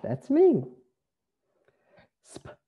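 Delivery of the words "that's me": high, louder "that's me!"; excited self-introduction. Real, not acted. Teacher cheerfully tells the class the name is his. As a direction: proudly point to yourself. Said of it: "[name]".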